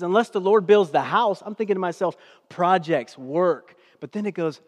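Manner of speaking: loud, driving sermon delivery; preaching. Real, not acted. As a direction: preach it hard to the congregation. Preaching at full force.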